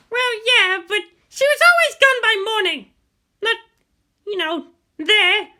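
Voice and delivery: high, loud voiced women arguing; female voices argue. Guardian and girl argue over the commander, heated female voices. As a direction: play both women hot-tempered.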